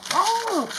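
a sharp high "Oh!" like a yelping dog; high surprised yelp. Acted. Dogs yelping for help, sharp high cry.

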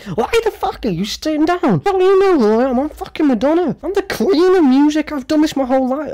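high, put-on diva voice; hammed-up entitled queen of music. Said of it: "she's like".

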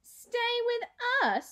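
high, slow sneering echo; scornful. A narrator's reading. Daughter sneering at the boy staying, high and slow. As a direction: drag it out in disdain.

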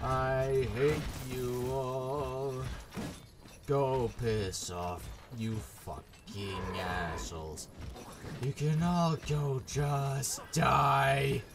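slow, drawn-out muttered hatred; slow grim cursing. Real, not acted. Cursing the enemies in a slow, bitter drawl. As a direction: drag every word out bitterly.

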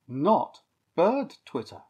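ordinary delivery

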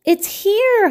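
high, loud excited holler. A narrator's reading. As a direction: call it out to the whole class. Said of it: "hollered Pig".

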